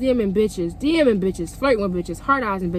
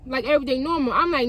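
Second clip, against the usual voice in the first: ordinary talk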